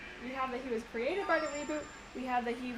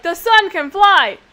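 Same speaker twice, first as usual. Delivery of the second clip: mock excited fan; 7 semitones higher, 22 dB louder, slower than usual. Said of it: "Everybody's like".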